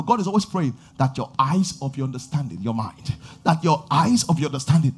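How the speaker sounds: ordinary talk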